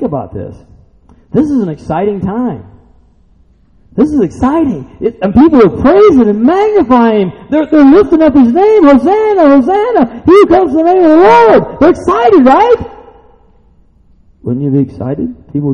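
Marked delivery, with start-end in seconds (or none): 1.3-8.8 high-pitched, building pulpit excitement; excited preaching. Real, not acted. 8.8-11.8 high, loud shouts of praise; praising crowd. Acted. 11.8-12.8 high, loud appeal to listeners; excited preaching. Real, not acted.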